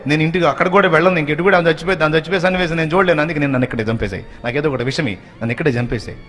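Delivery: frantic pleading. Acted. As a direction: blurt out the plea in a rush. Daughter-in-law frantic plea. (ఆత్రంగా వేగంగా వేడుకోవడం)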